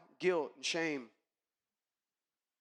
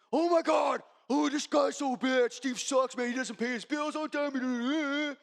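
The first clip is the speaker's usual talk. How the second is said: higher, louder mock gossip of slacker coworkers; 7 semitones higher, 5 dB louder, slower than usual. Imitating coworkers griping about Steve, high and loud. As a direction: ham up the lazy crew griping.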